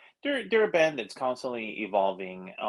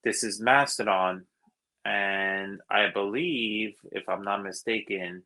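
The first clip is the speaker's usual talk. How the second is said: ordinary talk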